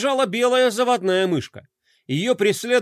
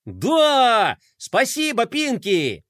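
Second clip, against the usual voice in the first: eager cheer; 5 semitones higher, much slower than usual. Acted. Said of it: "поддержал её Флай".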